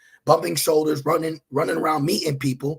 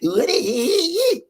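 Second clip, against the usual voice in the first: much higher mocking falsetto; mocking woman; 8 semitones higher, 4 dB louder than usual. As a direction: ham up a silly woman's whine. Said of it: "she goes".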